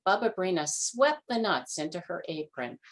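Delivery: plain reading voice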